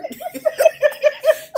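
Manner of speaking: loud, high-pitched burst of laughter; real laughter. Real, not acted. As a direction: crack up helplessly mid-story. Cracks up at the quote.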